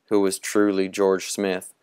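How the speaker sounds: ordinary delivery, nothing marked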